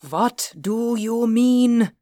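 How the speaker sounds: put-on slow, high accented character voice; agitated Reinhardt. A narrator's reading. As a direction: draw the question out, high and agitated. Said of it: "Reinhardt quivered violently".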